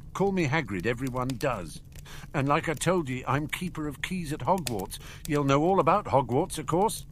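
lightly voiced genial gamekeeper; friendly giant gamekeeper. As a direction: introduce yourself in a homely dialect. Voices Hagrid introducing himself.

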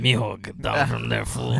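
slow scolding Mexican mom. Retold or imitated. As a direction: call the kid down, slowly. Friend voices the scolding mom.

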